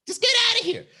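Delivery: very high mock yell; hammed-up high-pitched dismissal; imitated yelling tirade. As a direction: act out her throwing you out. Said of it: "yelling tirade".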